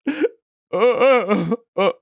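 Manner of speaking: high whimpering uh sounds; hammed-up nervous flyer sobbing; mock weeping. Imitating the weeping passenger in turbulence. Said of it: "he's like".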